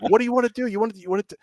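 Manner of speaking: high-pitched, retold flustered asking; urgent questioning. Developer asking a panicking player what he wants, retold urgency. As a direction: repeat it as if anxious to help.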